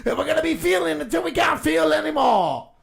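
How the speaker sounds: shouted rally rant. Acted. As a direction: yell it to the crowd.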